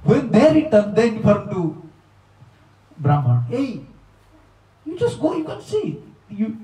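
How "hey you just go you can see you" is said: high, excited calling out; eager urging. Retold or imitated. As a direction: urge him on, pitch jumping high. Villagers urging Brahman to go.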